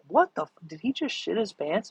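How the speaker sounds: mock-incredulous put-on voice; baffled onlookers. Everybody watching him crawl to the bathroom, mock disbelief. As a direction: imitate a bewildered bystander. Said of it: "everybody's like".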